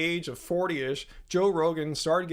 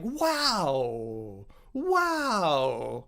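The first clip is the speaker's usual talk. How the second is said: inflected wow. Shown as a demonstration. Demonstrating emotional pitch inflection to viewers, "wow" rising high. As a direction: swing the pitch up on the second "wow" to show emotion. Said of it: "inflect a higher pitch onto a word or two".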